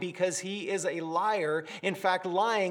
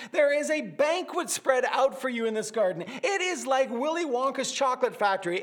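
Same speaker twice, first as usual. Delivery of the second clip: usual speaking voice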